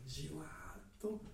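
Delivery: long, stretched-out じわーっと; slow seeping. Shown as a demonstration. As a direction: let じわーっと spread slowly, no sudden burst. Slow seeping contrasted with sudden gush. (じわーっとを長く伸ばして)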